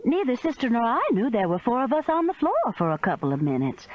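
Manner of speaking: usual speaking voice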